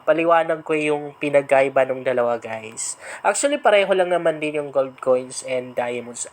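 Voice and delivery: usual speaking voice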